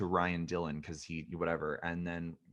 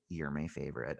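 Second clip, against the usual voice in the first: lowered, hushed imitation of an aunt; leaning-in confidence; 5 semitones lower, 7 dB quieter than usual. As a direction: confide it like a secret. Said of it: "they all lean in at some point".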